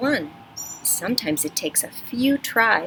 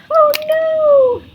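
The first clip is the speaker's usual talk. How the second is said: very high, loud squeal of alarm; hammed-up fright; mock panic; 6 dB louder than usual. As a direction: shriek it like a cartoon in danger. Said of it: "make sure your voice sounds exciting too".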